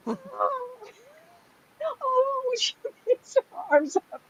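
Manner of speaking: high breathless laugh through the words; squealing with laughter. Real, not acted. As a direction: crack up remembering it. Laughing at caveman reveal.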